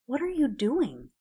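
pleading, pitched high; imploring. A narrator's reading. Neville begging Ron to stop, imploring. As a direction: beg him to explain. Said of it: "his tone imploring".

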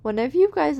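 normal talking voice

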